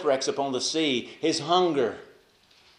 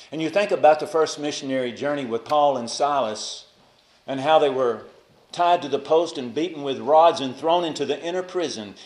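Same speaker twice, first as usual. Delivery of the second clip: ordinary talk, nothing marked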